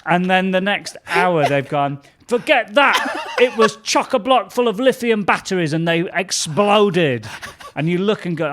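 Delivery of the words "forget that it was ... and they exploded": high, loud mock-breathless headline with a laugh in it; sensational tabloid voice. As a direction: blurt out the wild new theory like a tabloid. Voicing the next tabloid headline.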